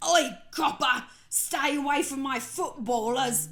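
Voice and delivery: slow, hammed-up Victorian cockney accent; mock cockney. Mocking a footballer's cockney slang, hammed-up cockney accent. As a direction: play a cartoon London thug in a cockney accent. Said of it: "Victorian era Jack the Ripper".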